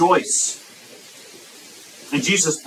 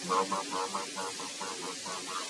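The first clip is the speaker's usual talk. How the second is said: a low, much softer grumbling mumble; low mumbling murmur; 9 semitones lower, 18 dB quieter than usual. Retold or imitated. Preacher mimics complainers, low grumbling murmur. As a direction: mumble it low and sullen under your breath. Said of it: "That's how it sounds when some of you talk".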